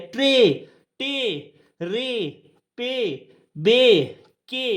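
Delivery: each of "ट्रे, टे, रे, पे, बे, के" held on ay; word by word, stretch "ट्रे, टे, रे, पे, बे, के"; long ay sound. Shown as a demonstration. Teacher showing which sound comes, long ay in "ट्रे, टे, रे, पे, बे, के". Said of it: "साउंड कौन सी आ रही है".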